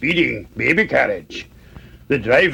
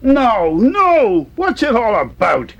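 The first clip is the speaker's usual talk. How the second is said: high, louder protest of no; exasperated outburst; 10 semitones higher, 4 dB louder, slower than usual. A narrator's reading. Young mouse fed up with all the no, exasperated. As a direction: burst out in frustration, pitch jumping up.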